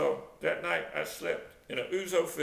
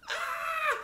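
high mock scream; short shriek, acting out the fall; high-pitched storyteller's scream; re-enacted scream. I would scream.

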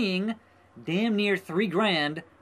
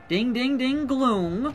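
bell imitation; 6 semitones higher, slower than usual.

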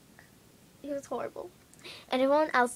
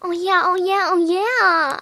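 higher, louder, slow sing-song; taunting friend; 7 semitones higher, 10 dB louder, slower than usual. Retold or imitated. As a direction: drag out the "oh yeah" like a teasing friend. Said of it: "And she's like".

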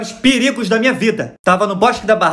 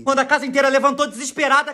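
ordinary talk

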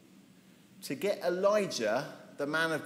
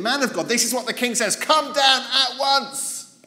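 shouted order; 8 semitones higher, 12 dB louder than usual. Acted. Second captain shouting up the hill at the prophet. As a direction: drag out each word like a furious yell.

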